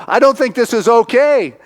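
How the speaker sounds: high, loud mock protest; alarmed objection. Eleanor objecting to boy's wrestling match, high protest.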